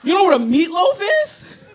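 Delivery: furious yell about a meatloaf; exasperated yelling. Retold or imitated. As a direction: yell at the sister in disbelief. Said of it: "I was like".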